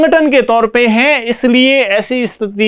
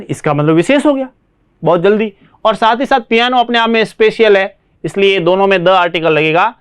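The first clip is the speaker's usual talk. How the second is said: ordinary talk, nothing marked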